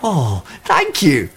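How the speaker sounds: happy little cry; cried out. A narrator's reading. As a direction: thank him with real joy. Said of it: "cried Little Bear".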